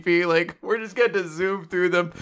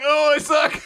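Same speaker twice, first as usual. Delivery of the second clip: mock cry; 5 semitones higher, 3 dB louder than usual. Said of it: "Code's like".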